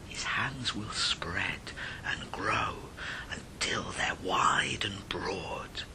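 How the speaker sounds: slow, soft, spooky whisper; slow creepy whisper building to the final warning; hushed ghostly warning. A narrator's reading. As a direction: draw it out to give them chills.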